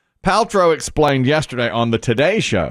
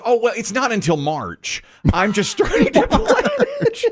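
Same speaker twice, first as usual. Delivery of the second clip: high-pitched mock anchor reply; 11 semitones higher than usual. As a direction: imitate a fussy birthday planner, high. Mocking male anchor's birthday reply.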